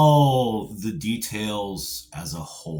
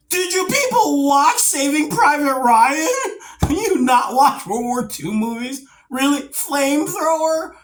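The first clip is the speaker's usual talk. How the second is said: much higher, louder mock disbelief; incredulous; 4 dB louder than usual. Real, not acted. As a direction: keep asking as if they can't be serious. Incredulous rant about movie flamethrowers.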